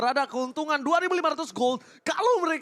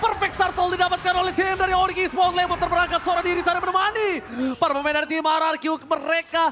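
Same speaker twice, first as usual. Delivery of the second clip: esports caster; 6 semitones higher than usual. Acted. Live play-by-play.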